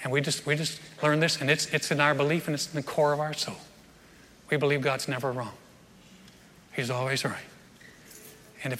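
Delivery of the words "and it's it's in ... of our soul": usual speaking voice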